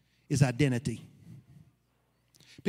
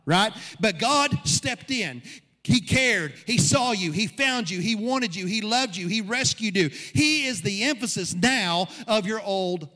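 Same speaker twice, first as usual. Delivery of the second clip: higher, rising sermon voice, hammering each phrase; preaching at full force; 6 semitones higher, 3 dB louder than usual. Acted. Pastor preaches to his church, driving the point home. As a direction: build the congregation up with every line.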